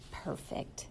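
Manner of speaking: whispered perfect after a pause; whispered payoff. A narrator's reading. Narrator whispering the final word to the children. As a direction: breathe the last word as a secret.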